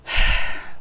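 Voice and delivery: loud exasperated sigh; annoyed sigh. Retold or imitated. The bald alien losing patience with her, a loud annoyed sigh. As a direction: huff out an impatient sigh. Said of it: "he's just annoyed with me".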